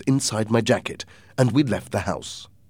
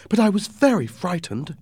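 frightened mother; 10 semitones higher, 3 dB louder than usual. Acted. Mother admits her fright to rescuer.

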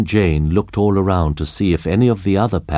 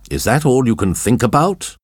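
mildly angry, pitched-up challenge; indignant higher question; 6 semitones higher than usual. A narrator's reading. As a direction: reproach him with a touch of anger. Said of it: "John spoke angrily".

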